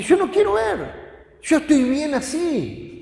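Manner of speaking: high-pitched imitation of refusal; blind man refusing. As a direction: imitate a man insisting he's fine, voice jumping up. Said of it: "él siempre decía". (imitando una negativa en voz aguda)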